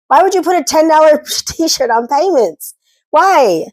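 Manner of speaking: high, incredulous and laughing; can't believe anyone would do this; exasperated disbelief. Real, not acted. Disbelief at t-shirt payment plans.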